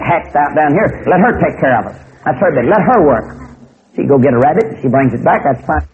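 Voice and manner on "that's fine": ordinary talk, nothing marked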